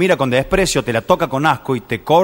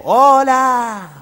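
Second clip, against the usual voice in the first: high distant echo voice; cave echo; 8 semitones higher than usual. Acted. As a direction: sound like the echo answering back. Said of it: "Y decía el eco".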